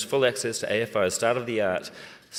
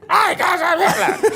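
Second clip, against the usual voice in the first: Hitler! shrieked much higher and louder, laughing; top of his range; 8 dB louder than usual. Shown as a demonstration. Actor showing the top of his acting range, a high laughing shriek. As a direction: squeal Hi guys I'm Hitler as high as you can go. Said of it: "this is my range as an actor".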